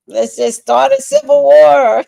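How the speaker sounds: high, loud exclamation; mock rallying cry. Reacting to an Oscar snub, mock rallying cry. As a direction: throw it out as a hammed-up outburst.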